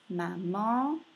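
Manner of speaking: "maman" with last vowel nasal, no closing N; nasal sound. Shown as a demonstration. Teacher models French for learners, nasal final vowel. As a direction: end "maman" through the nose. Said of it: "suono nasale".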